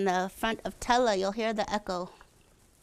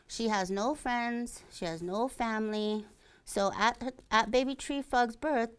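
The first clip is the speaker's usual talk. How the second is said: ordinary delivery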